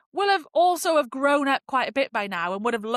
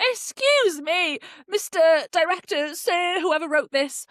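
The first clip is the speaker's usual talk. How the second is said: mock indignation; 7 semitones higher, 4 dB louder than usual. Fan scolding the director over the death, mock-indignant. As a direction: play the outraged complainant.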